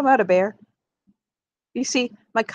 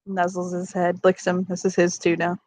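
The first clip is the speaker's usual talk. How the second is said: ordinary delivery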